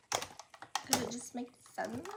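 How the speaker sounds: slow, soft put-on voice; odd voice. Real, not acted. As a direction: drawl it out softly. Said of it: "I don't know why I'm talking like that".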